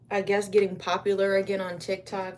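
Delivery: ordinary talk, nothing marked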